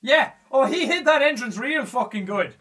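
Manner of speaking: normal talking voice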